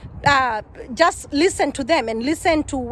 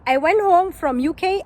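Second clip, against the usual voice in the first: ordinary talk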